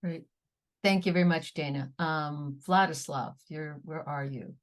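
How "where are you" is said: ordinary delivery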